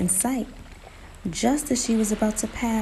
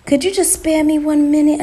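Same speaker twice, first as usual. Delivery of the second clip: high, loud, wheedling plea; begging; 8 semitones higher, 9 dB louder than usual. A narrator's reading. Spider begging Bro Bull for help, pleading. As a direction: beg for just a moment. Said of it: "Anansi begged".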